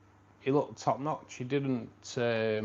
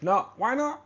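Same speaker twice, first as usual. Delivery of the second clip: jokey high-pitched, louder challenge; mock indignation; 12 semitones higher, 7 dB louder than usual. Streamer teasing a viewer about not being from Manchester, mock indignant. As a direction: shoot up high in pretend outrage.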